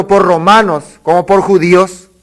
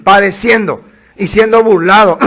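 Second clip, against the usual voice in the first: ordinary talk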